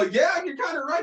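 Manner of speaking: very high, laughing retold reply; amused concession. Dad agreeing with son about second place, high and laughing.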